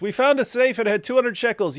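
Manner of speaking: lightly voiced soldier proudly showing a tiny find. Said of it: "you say".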